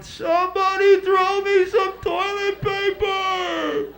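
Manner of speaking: desperate high slow shout for help; stranded kid hollering down from upstairs; kid yelling. Retold or imitated. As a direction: bellow down the stairs, stretched out. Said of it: "you'd hear a kid yelling".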